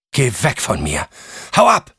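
high, loud shout to drive him off; frightened shouting. Acted. Alicia lashes out at an unseen intruder, shouting. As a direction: yell it, jumping up sharply in pitch on the last words. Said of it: "rief eine Stimme".

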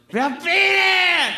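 put-on very high, loud, slow drunken yell; drunken shout. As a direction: bellow it into the night, drunk. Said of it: "hab geschrien".

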